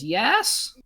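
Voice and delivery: small goblin tells on the party; little goblin voice. Acted. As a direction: chirp it like a tattletale goblin. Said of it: "little goblin says".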